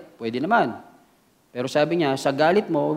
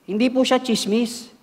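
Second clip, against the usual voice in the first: higher, pleading churchgoer excuse to the pastor; mock excuse; 7 semitones higher than usual. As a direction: play the member making excuses, a bit whiny. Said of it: "Sabi natin". (nagdadahilang miyembro sa pastor, mataas na boses)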